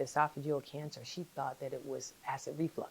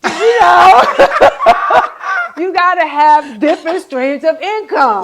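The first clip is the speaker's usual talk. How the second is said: very high, loud and laughing; excited, laughing shout; 13 semitones higher, 18 dB louder than usual. Real, not acted. Guest telling host she has more business, shouting excitedly. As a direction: holler it gleefully through big laughs.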